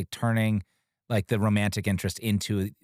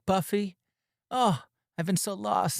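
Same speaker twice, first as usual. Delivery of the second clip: mock lovesick Angel; 9 semitones higher, slower than usual. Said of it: "And he's like".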